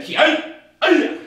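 very high, loud karate yell; kiai shout. Real, not acted. Instructor finishing push-up count, kiai shout. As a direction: let out a full kiai.

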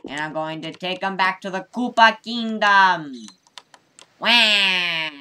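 loud, high battle shout; villain battle cry. Acted. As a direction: yell it as you charge.

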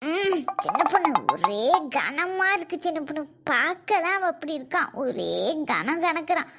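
low, soft strained voice; straining under weight. Acted. Woman hauling a heavy body, low and strained. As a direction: sound like lifting something heavy.

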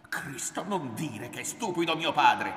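loud, high, angry outburst; outraged protest. A narrator's reading. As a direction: explode in defence of your father. Son outraged at insult to father.

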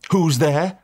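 demanding. Acted. Narrator demanding who knocked at his door. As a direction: call out high and loud, demanding. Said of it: "I demanded".

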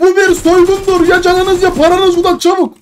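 hammed-up hold-up threat; mock robber shout. Acting robber in game. (soyguncu gibi bağırarak)

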